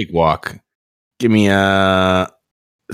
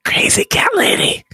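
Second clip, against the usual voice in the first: whispered shout. Real, not acted. Player shouts playful jab.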